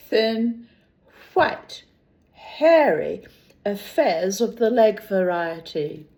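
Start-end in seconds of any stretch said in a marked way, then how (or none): none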